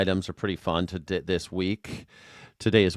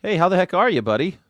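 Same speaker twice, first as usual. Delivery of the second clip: loud cheery greeting; 8 semitones higher, 5 dB louder, faster than usual. Real, not acted. Greeting guest.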